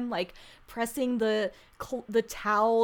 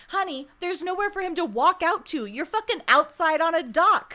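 sassy scolding; 6 semitones higher, 6 dB louder than usual. Real, not acted. Scolding Becca on screen.

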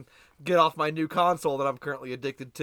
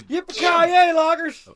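high, loud yippee-ki-yay whoop; cowboy whoop; 12 semitones higher, 9 dB louder, much slower than usual. Pretend. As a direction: holler it like a rodeo cowboy.

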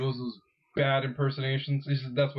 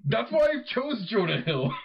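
laughing; 9 semitones higher than usual. Real, not acted. Laughing about Fat Jonah.

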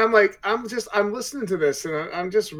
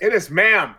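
mock shout; 3 semitones higher, 8 dB louder, slower than usual. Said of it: "I could hear Gwen shouting".

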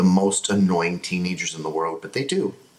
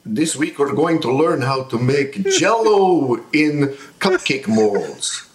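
ghost voice; 6 semitones higher, 4 dB louder, slower than usual. Retold or imitated. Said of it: "That's the same voice".

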